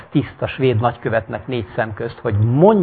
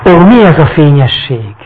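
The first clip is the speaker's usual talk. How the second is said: loud, higher awed cry; wonder; 4 semitones higher, 11 dB louder than usual. Acted. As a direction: marvel at the light. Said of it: "mondta a vak".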